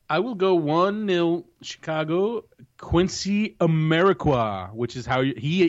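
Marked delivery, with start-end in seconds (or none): 2.8-4.7 Quincy Amarikwa said slowly, every syllable clear: KWIN-see a-MER-a-kwah; careful pronunciation. Shown as a demonstration. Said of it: "how he himself pronounces his name".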